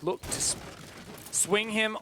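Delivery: usual speaking voice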